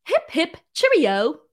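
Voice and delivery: high, hammed-up English accent; terrible English accent. Shown as a demonstration. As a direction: sing out a bad English accent. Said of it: "sorry for my terrible English accent".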